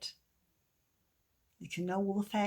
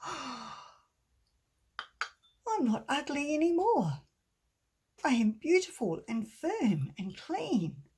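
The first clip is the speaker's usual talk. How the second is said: joyful wonder; 6 semitones higher, 4 dB louder than usual. Acted. Cup marvels at its reflection.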